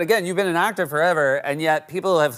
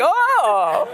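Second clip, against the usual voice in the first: high, drawn-out mimicked "oh"; kids' letdown; 12 semitones higher, 4 dB louder than usual. Father imitates both kids reacting, high drawn-out realization. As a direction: voice kids getting it, pitched way up.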